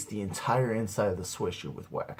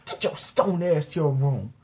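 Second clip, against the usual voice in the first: high-pitched scolding mother voice; screaming angry mom; 6 semitones higher than usual. Retold or imitated. Mom ordering stoned son upstairs, imitated angry yelling. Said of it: "she's screaming and yelling at me".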